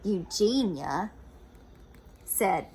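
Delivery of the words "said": plain reading voice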